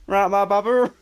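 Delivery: put-on jokey West Country yokel accent. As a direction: ham up a broad West Country greeting. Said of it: "funnier in a West Country accent".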